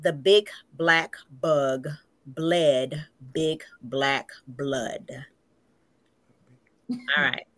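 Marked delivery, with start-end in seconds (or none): 0.0-5.1 slow, deliberate tongue twister; each word stretched out slowly. Shown as a demonstration. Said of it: "you start off slow".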